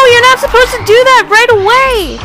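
loud, high protest at the game; loud indignant outburst; outraged yell. Real, not acted. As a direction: yell in real frustration, pitch way up. Game enemy attacks her too early.

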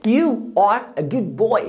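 very slow, each word spaced; slow model phrase. Shown as a demonstration. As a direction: stretch it out for the pupil. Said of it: "teacher models sentence slowly". (ببطء شديد)

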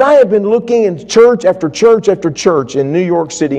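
a man at the end of his rope; high, loud desperate confession; desperate outpouring. Retold or imitated.

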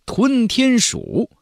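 high, slow and weighty; awed naming. A narrator's reading. Narrator revealing the sky-devouring rat, slow and awed. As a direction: let the name ring out in awe.

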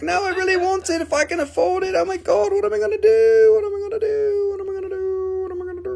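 high, whiny mock panic, breaking into fake crying. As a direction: play a buyer frantic over the price. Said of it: "Everybody's like".

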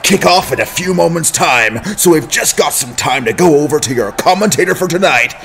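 loud, booming arena announcer hype; sports announcer. Acted. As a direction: pump up the crowd before kickoff.

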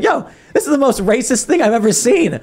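high, incredulous mock outrage; imitating shocked Seamus. Roommate reacting to the movie, high shocked imitation. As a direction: pitch it way up in disbelief.

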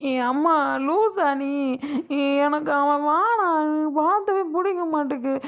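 wailing protest to mother; tearful pleading. Acted. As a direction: beg through sobs, stretching the word endings. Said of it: "அழுகாம கண்ணை தொடச்சிட்டு". (அழுதுகொண்டே அம்மாவிடம் கெஞ்சுதல்)

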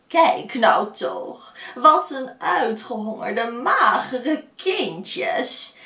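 mock-kind old woman luring children; friendly old woman. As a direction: coax like a kindly granny. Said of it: "Gelukkig sprak ze vriendelijk".

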